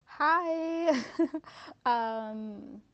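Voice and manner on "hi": normal talking voice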